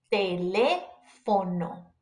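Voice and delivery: slow "Teléfono", leaning on LÉ; stress on the accented vowel. Shown as a demonstration. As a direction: draw out the LÉ in Teléfono. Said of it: "put emphasis on it when you pronounce it".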